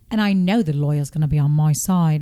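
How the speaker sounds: mock posh British voice; regal British accent. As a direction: imitate a regal British speaker. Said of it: "a very British person".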